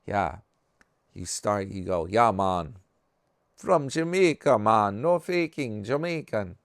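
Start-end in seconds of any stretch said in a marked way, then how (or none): 2.1-6.6 exaggerated Jamaican impression with swooping pitch; mock Jamaican accent. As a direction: ham up a lilting Jamaican accent. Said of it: "Jamaican one".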